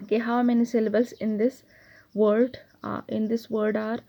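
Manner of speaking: usual speaking voice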